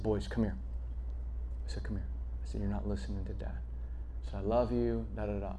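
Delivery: a soft, gentle father's voice. Retold or imitated. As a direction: talk softly to your sons. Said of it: "from a place of gentleness".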